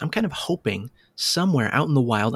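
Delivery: usual speaking voice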